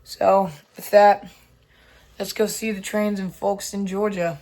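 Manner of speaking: hoarse, strained lost voice. Real, not acted. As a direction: speak through a lost voice. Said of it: "I've lost my voice".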